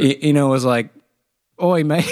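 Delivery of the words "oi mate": high, laughing Cockney "Oi, mate"; hammed-up British accent, breaking into a laugh; mock London geezer greeting. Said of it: "I was about to do a British accent".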